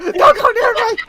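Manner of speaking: very high, loud yell; hammed-up shriek to keep someone away; mock panicked shout. Caught in his room by mother, mock panicked shout.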